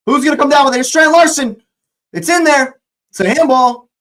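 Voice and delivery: high, loud live call of the action; excited play call. Real, not acted. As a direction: call the chance as it happens.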